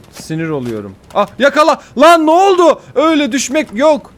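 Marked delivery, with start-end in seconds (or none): 1.1-4.1 high, loud startled yelling at the game; panicked shout. Real, not acted. Gamer yelling at his character as it nearly falls, panicked shout. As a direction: yelp it out as the jump nearly fails.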